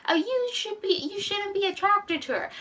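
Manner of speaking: high, whiny mocking voice; mocking chorus. Everyone scolding Bruce about the girl, high and whiny. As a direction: mimic the others, high and whiny. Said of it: "everyone's like".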